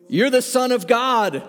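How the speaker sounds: loud, high demonic cry. Acted. Demons cry out recognizing Jesus, loud high shout. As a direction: shout it like a possessed voice.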